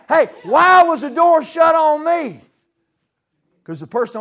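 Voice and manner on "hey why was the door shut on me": high, loud indignant cry; preaching shout. Real, not acted. Preacher to the congregation, loud indignant shout. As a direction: shout it at the room.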